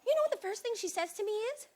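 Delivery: normal talking voice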